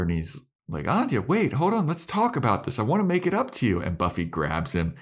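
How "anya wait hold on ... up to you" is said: high-pitched mock pleading; imitated pleading. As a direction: call after her in a high, urgent imitation. Acted chase, begging her to stay.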